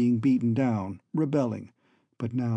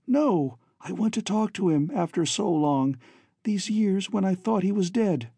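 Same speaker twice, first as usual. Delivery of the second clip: put-on slightly higher young woman's plea; pleading daughter; 6 semitones higher than usual. Daughter begs to talk to her long-lost father, pleading. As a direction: plead lightly, as a captive daughter.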